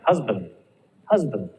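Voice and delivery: "Husband" with a weak schwa in the second syllable, "huz-bənd". Shown as a demonstration. Teacher models "Husband" for students, schwa on band. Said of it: "Huz is the stress part".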